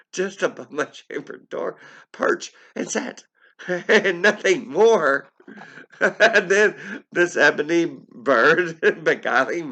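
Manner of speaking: breaking into laughter, voice shooting high; high laughing voice that can't stay straight. Real, not acted. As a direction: read through real giggles. Narrator cracking up mid-reading.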